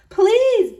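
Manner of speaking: high, loud desperate plea; husband pleading with the witch, loud; begging. A narrator's reading. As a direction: beg for mercy. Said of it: "begged the husband".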